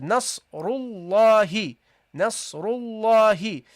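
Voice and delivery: slow "Nasrullahi" with a long, loud "la"; "Nasrullahi" recited slowly, stretched on the heavy "la"; prolonged fatha. Shown as a demonstration. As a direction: hold the "la" in "Nasrullahi". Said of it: "that's how you pronounce it".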